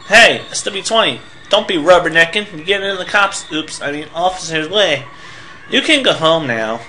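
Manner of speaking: put-on high-pitched, nagging kid character voice; bossy Pokey, scolding. Pokey telling a kid to stop bugging officers, bossy. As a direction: scold from up high, full of self-importance.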